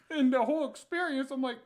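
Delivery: normal talking voice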